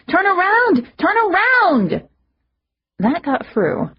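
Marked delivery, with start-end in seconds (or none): 0.1-1.9 high, loud panicked yell. A narrator's reading. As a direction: yell it at the driver.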